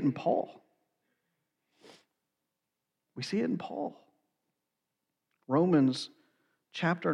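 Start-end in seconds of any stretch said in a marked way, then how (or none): 3.1-4.0 soft, hushed and higher, almost breathed; hushed repeat. Real, not acted. Preacher repeats it to the congregation, soft and hushed. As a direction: let it fall away to a hush.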